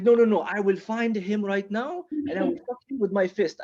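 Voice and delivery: put-on high, vowing revenge in a foreign accent; accented avenger, earnest and worked up; Ahmed. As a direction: swear it passionately in high accented English. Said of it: "like, a passionate friend".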